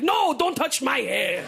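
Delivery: put-on slow Italian-accented insistence; mock the Italian ex saying it slowly. Italian ex in bed echoing her, slow Italian accent. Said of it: "He's like".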